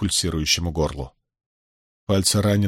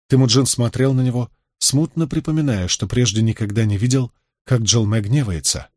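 ordinary delivery

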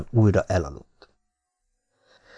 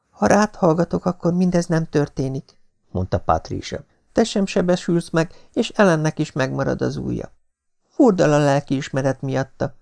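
put-on much higher young woman's voice, regretful; Patricia's remorseful voice; 7 semitones higher than usual. A narrator's reading. Patricia regretting to the doctor, high and remorseful. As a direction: confess your guilt in a high voice.

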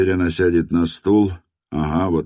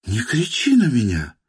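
higher, a touch hurt; woman pleading, pitched up; young woman voice; 6 semitones higher than usual. A narrator's reading. (женский голос, обиженно)

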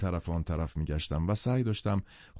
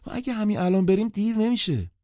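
high, raised woman's voice; insistent, loud and high; loud reply; 12 semitones higher, 5 dB louder than usual. A narrator's reading. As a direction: answer out loud, pitched well up. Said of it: "بلند جواب داد".

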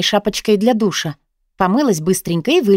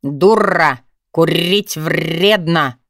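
slow parrot squawk, rolled r's; much slower than usual. A narrator's reading. Unknown voice carries on from the flat, parrot squawk. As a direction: drag it out like a talking bird, trilling the r. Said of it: "А неизвестный голос продолжал".

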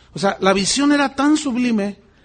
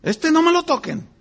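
protective fan; 9 semitones higher than usual. Pretend. Mimics a fan blindly adoring a preacher.

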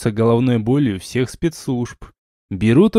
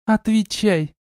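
mild barked order; 9 semitones higher, slower than usual. A narrator's reading. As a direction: demand an answer in a higher voice. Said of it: "гаркнула Чейта".

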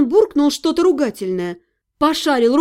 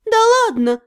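drawled disbelief; 7 semitones higher than usual. A narrator's reading. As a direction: stretch it out, unconvinced. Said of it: "уже увереннее протянула я".

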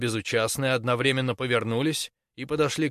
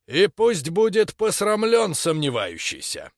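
solemn declaration; 6 semitones higher, 5 dB louder than usual. Acted. Witch hunter declares solemnly.

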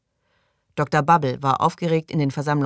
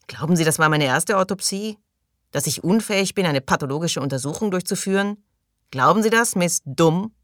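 rising, higher-pitched indignant questioning; 4 semitones higher than usual. Acted. Angry doctor rails at his critic. (empört, mit steigender Stimme)

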